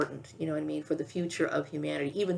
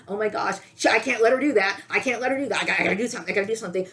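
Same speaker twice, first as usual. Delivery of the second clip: mock alarmed aliens; 4 semitones higher, 5 dB louder, much faster than usual. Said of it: "the little activation goes off in these aliens' mind".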